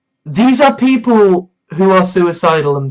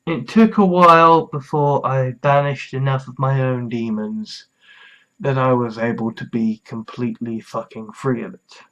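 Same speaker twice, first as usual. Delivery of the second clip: soft sincere; 5 semitones lower, 6 dB quieter than usual. Real, not acted. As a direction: speak gently to someone struggling.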